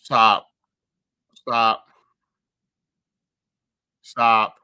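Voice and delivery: loud, falling stop, repeated; loud pleading stop. Real, not acted. Streamer begging the tattoo video to stop, loud falling stops. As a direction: beg it to stop, loudly.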